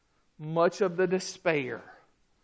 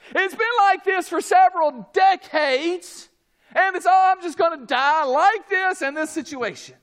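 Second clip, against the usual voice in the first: loud, high mock complaint; raised, exasperated pulpit delivery; impassioned preaching; 14 semitones higher, 9 dB louder than usual. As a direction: preach the hopeless complaint at full voice. Voices a despairing believer, preaching.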